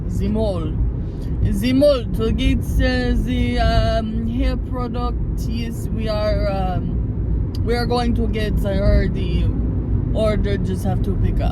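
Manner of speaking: put-on vague Italian-French accent, halting with ums; badly faked accent drifting from French toward Dracula; muddled European accent. Driver playing around on the way to the mall, muddled European accent. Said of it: "what kind of accent that was".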